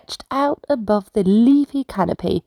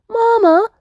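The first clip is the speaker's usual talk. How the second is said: a high, louder little call; piping up; 4 dB louder than usual. Acted. Little sloth calls to her mama, piping up. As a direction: pipe up brightly for attention. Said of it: "Coco piped up".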